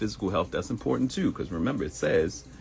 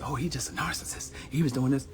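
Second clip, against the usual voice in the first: higher mock gossiping voice; 8 semitones higher than usual. Mocking people who call everyone a narcissist, lightly higher. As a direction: lightly parody a complaining girlfriend.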